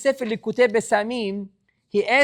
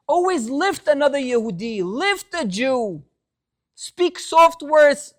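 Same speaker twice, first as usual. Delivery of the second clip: impassioned preaching; 4 semitones higher, 3 dB louder than usual. Acted. God rebukes Moshe, impassioned preaching.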